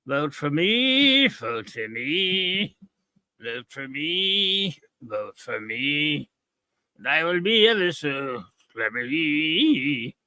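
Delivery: very slow, drawn-out campaign chant in a put-on voice; quoting the episode. Reviewer quoting the Free for All campaign in a very slow put-on chant. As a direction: drag out each word like a woozy candidate. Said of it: "from Free for All, look".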